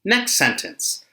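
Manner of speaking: ordinary talk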